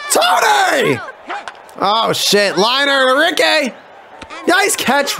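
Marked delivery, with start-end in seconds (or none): 0.1-1.0 high, drawn-out yell of a name; real frustrated calling out; exasperated shout. Real, not acted. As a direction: holler at a player who messed up. Yells at player who failed.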